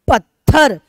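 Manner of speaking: पत्थर loud, with त्थ hit hard; loud पत्थर demo stressing त्थ. Shown as a demonstration. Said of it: "तथ पे हम ज्यादा बल दे रहे हैं".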